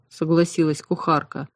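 ordinary delivery, nothing marked

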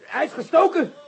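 shocked cry. Acted. Commissioner stung, alarm.

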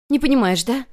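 high, loud, cutting question; furious challenge. A narrator's reading. Girl thrusts a bloody photo at her rival, furious. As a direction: throw it in her face.

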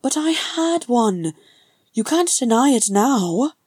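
high, loud triumphant gloat; crowing. Acted. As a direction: crow over the small victory. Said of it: "Frerin crowed".

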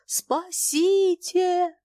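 high, long-drawn cry for help; panicked, high stretched scream; thin rattling cry. A narrator's reading. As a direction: wail it thinly in animal fear. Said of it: "тонкий дребезжащий крик Званцева".

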